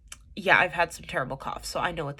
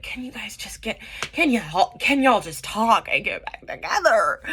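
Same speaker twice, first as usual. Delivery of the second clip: starts in a whisper, then bursts out louder and slower; frustrated plea; 4 semitones higher, 6 dB louder than usual. Retold or imitated. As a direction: build from a hushed plea to a loud exasperated demand.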